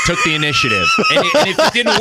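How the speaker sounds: words squeezed out mid-laugh. Real, not acted. Laughing while talking.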